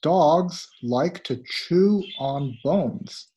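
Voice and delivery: slow and clear for learners. Shown as a demonstration. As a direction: read it out slowly, word by word. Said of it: "slow teaching take".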